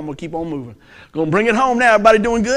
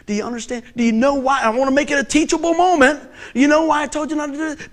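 higher, insistent lecture questions; scolding dad; 7 semitones higher than usual. Retold or imitated. Dad lecturing his son on obedience, mock scolding. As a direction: press the child like a fed-up dad. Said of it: "And I was so mad".

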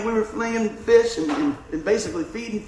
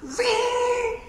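sharp imitated reel whizz; high zing of a cast line. Preacher imitates stranger's cast whizzing across, high zing.

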